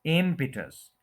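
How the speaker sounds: Impetus as IM-pi-tus; impetus falling away from a stretched IM; stress on first syllable. Shown as a demonstration. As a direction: lean on IM in impetus, short pi. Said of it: "first के ऊपर stress है".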